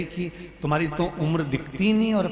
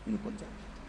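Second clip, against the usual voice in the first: whispered plea; 5 semitones higher, 17 dB quieter than usual. Retold or imitated. Son begging guru while weeping.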